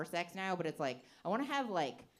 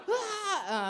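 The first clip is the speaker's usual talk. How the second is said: mock alarmed shout; 13 semitones higher, 7 dB louder than usual. Acted cry in the ad parody.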